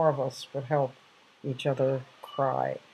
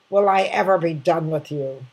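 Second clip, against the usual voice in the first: louder exasperated outburst, retold; imitating an angry father; 2 semitones higher, 7 dB louder than usual. Father scolding his grown daughter, mock exasperated and loud. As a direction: let the father's exasperation burst out. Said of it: "my father getting very angry at me".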